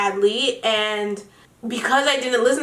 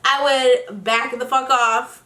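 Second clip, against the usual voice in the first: normal talking voice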